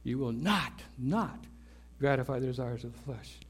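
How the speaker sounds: low, soft, with "not" said twice deliberately; emphatic double NOT. Shown as a demonstration. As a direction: set each "not" apart, quietly insistent. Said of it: "It's emphatic".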